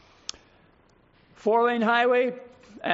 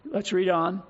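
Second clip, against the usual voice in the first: ordinary delivery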